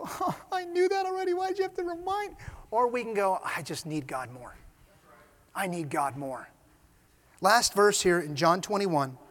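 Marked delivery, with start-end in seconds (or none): 0.0-2.3 high-pitched put-on griping; mock complaint. A believer resenting being reminded of weakness, mock complaining. 3.4-6.5 low, soft confession; humble admission. Acted. A believer admitting need of God, humbly. Humble answer set against complaint.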